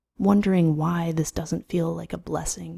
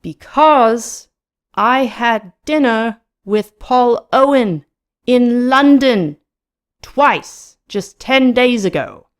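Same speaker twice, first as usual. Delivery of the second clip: slow, high, loud enunciation; deliberate shout, phrase by phrase; shouting back clearly; 7 semitones higher, 7 dB louder, slower than usual. A narrator's reading. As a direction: call each phrase over club noise. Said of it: "shouts back very clearly over the din".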